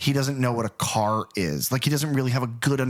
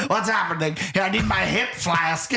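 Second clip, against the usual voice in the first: higher, mock-drunk bewilderment; plastered; 6 semitones higher than usual. Actor needing his flask on set, mock-drunk confusion. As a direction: imitate a sozzled actor, pitched up. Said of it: "he seems like he was plastered".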